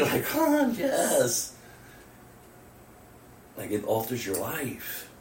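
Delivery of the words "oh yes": high-pitched thrilled exclamation; excited. Acted. Believer responding to the gospel, excited. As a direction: let the voice leap up with delight. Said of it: "you need to be excited".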